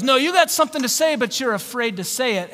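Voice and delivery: high, loud, fast accusation easing off; mock drunk insistence. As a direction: imitate a drunk pressing his point. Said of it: "he's still drunk".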